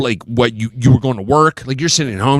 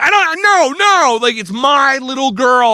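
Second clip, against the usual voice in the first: flustered high voice; 9 semitones higher, slower than usual. Retold or imitated. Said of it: "I was like".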